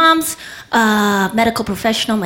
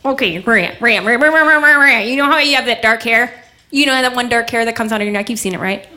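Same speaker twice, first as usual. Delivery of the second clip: sister's teasing; 2 semitones higher, faster than usual. Retold or imitated. Older sister giving her a hard time, nagging tease. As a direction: pester her with the name, then needle on. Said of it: "she's always giving me a hard time".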